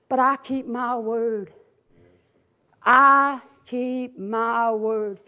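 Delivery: low, slow, deliberate; solemn promise. Retold or imitated. God's promise repeated softly, deliberately.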